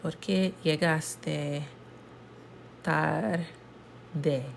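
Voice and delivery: "¿Por qué llegaste tarde?" read slowly and softly in careful Spanish. Shown as a demonstration. Teacher reads the Spanish example to class slowly. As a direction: spell out each syllable slowly, softly. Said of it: "So this one is".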